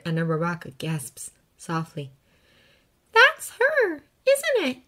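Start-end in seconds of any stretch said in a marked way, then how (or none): none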